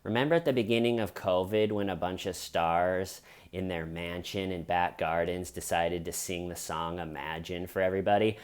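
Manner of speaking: normal talking voice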